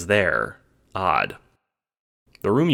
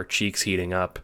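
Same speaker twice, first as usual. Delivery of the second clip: ordinary delivery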